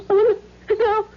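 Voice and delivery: frightened cry. Acted. Wife recognizing the stranger at the door, frightened cry. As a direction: gasp it out as the horror dawns. Recognizes the husband she thought dead.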